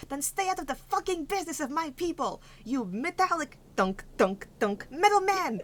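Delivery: high, loud furious yelling; fast, high-pitched outburst building to loud jabbed insults; angry halfling. Acted. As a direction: tear into them at the top of your voice. Angry halfling attacking and cursing Valor.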